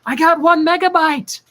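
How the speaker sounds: high mock Ron calling out; pedaling Ron. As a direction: yelp it out like an exhausted pedaler. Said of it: "he's like".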